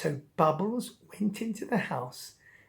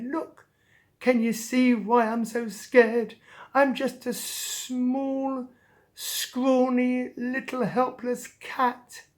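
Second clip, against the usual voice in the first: high, drawn-out pitiful cat voice; self-pitying cat; 6 semitones higher, slower than usual. Acted. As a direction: whine slowly as a helpless little cat. Cat pities himself at mirror.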